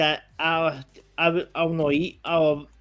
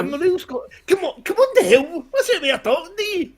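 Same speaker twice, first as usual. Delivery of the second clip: higher, heated protest with a laugh; fired up Scottish; 10 semitones higher than usual. Real, not acted. Scot protesting he is real, fired up. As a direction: get worked up and defend yourself. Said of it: "I love when he gets fired up".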